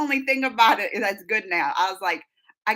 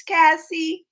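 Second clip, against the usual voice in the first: ordinary talk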